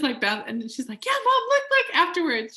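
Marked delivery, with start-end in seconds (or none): 1.0-2.6 put-on much higher, louder thrilled kid; excited teen. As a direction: gush like a teen showing off her new phone. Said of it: "She's, like, so excited".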